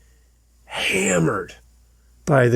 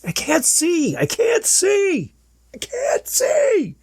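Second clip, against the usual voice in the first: rising panicked cry, each repeat higher and slower; panicked yelling; slower than usual. Retold or imitated. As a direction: yell it in rising terror, breaking into a cry. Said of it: "he's yelling at the trainer".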